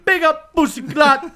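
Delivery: mock Jamaican accent. [name] mimics Chet's Jamaican catchphrase for the friends. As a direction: bark it in a hammed-up Jamaican patois. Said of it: "I'm gonna mimic what he says".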